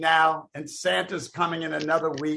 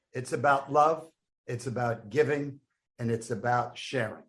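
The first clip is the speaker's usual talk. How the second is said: a hammed-up heartfelt toast; mock sincere; 6 semitones lower than usual. Comic closing his Santa roast with mock sincerity.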